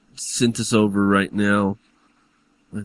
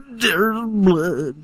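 put-on hammy German accent, again higher; 8 semitones higher than usual. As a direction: try the German accent once more. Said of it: "think of the German accent".

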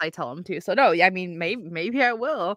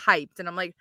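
ordinary delivery

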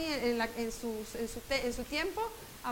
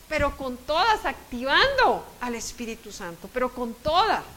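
loud, fervent, higher pulpit voice; preaching at full force; 5 semitones higher, 6 dB louder than usual. Acted. Preacher urging the congregation to give God a chance, fervent. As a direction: preach it out with rising urgency.

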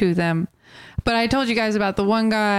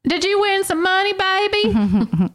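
strut in like a brash rapper; mock gangster; 10 semitones higher than usual. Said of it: "I said".

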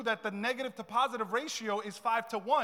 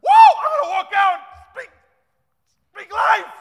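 mock fired-up shout, much higher and louder; hyped churchgoer; 11 semitones higher, 23 dB louder than usual. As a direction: ham up the pumped listener leaving church. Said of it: "we hear a message and we're like".

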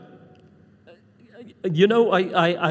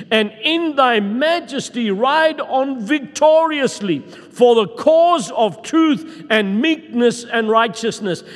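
high, loud, slow proclamation; preaching; 6 semitones higher, 6 dB louder, slower than usual. Acted. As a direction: proclaim the scripture like a herald. Reads psalm with preaching force.

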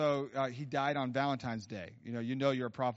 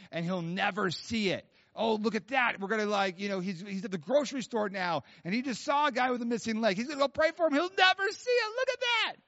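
hammed-up glee, climbing louder and higher; mock gloating; 8 semitones higher, 4 dB louder than usual. Mock God pranking a dreamer to Gabriel, gleeful mounting squeal. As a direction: build from raised pitch to high squeal.

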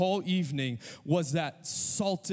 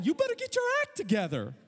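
exasperated scolding. Retold or imitated. Griping about slow shoppers.

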